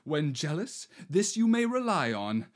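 normal talking voice

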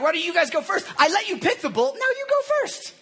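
high-pitched, incredulous cocky needling. Retold or imitated. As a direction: imitate a cocky challenger, pitch climbing on each jab. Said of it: "he's a little cocky".

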